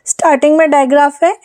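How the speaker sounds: usual speaking voice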